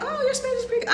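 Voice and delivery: high mock-impressed listener; gushing mock praise. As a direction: put on a high, sing-out voice as someone complimenting you. Mimics locals praising her Spanish.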